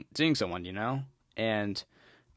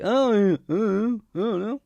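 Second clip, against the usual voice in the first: higher, mock-stammering ex; mocking her; 8 semitones higher, 6 dB louder than usual. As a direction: whine a flustered denial, higher. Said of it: "And you're like".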